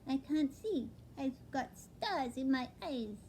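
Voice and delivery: high, small owlet voice; a young owl, wide-eyed and dazed; little owlet voice. Acted. Owlet telling his father, high and small. Plop's voice in the story.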